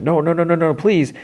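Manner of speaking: high, rising panicked no's; panicked plea. Retold or imitated. Fiona swerving the bulldozer toward Gary's camper, high panic. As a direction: beg as the crash comes.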